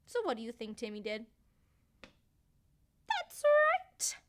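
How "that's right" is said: excited. Real, not acted. Excited answer to children.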